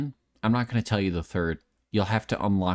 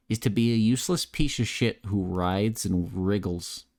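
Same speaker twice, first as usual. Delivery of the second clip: normal talking voice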